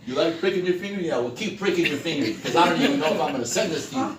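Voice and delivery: rising to a loud, high, mocking pitch; mock sales call. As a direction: ham up a snooty caller. Mock snooty caller continues.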